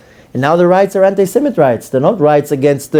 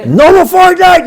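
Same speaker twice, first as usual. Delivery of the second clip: high, loud laughing wail of protest; mock kid outcry; 13 semitones higher, 5 dB louder than usual. Imitating kids losing Fortnite, high loud laughing protest. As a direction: cry out like a robbed kid.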